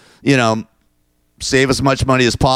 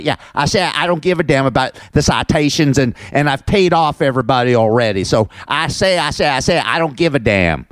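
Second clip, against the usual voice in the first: Foghorn Leghorn; 5 semitones higher than usual. Retold or imitated. Mocking the vice president as a cartoon rooster. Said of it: "I call him Foghorn Leghorn".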